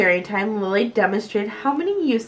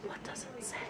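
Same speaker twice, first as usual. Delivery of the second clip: soft whispered question; 2 semitones higher, 22 dB quieter, much faster than usual. A narrator's reading. Reading the note, a soft whispered question. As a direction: breathe the question under your voice.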